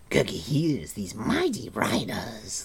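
slow, odd creature voice. A narrator's reading. Gurgi relating what he overheard, slow creature voice. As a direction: tell it secretively. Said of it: "the creature went on".

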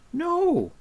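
high, urgent protest; alarmed cry. A narrator's reading. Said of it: "cried Taran".

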